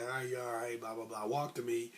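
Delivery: low, soft, offhand referee patter; routine referee check. Retold or imitated. Referee counting off a boxer, low and offhand. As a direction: run through it quietly, like mere procedure.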